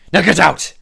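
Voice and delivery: loud, high dismissal in character; acted shout. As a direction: shout them out of the room as the lord. Lord throws his visitors out.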